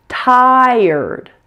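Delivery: slow, drawn-out "Tired" with a full er before the d; careful model pronunciation. Shown as a demonstration. Teacher shows learners how to pronounce this word, careful model pronunciation of "Tired". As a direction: stretch "Tired", blending er into d smoothly. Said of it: "Pronounce this word like this".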